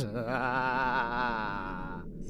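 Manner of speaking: breathy unvoiced snicker; quiet sinister chuckle; whispered evil chuckle. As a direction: laugh under your breath with malice. Villain's quiet gloating laugh.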